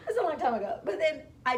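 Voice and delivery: laughing high and loud. Real, not acted. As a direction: laugh it off, embarrassed. Laughing at old outfit.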